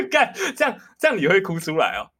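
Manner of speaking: laughing high on the first words. Real, not acted. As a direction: still giggling while answering. Laughing back at the teasing. (笑着回嘴)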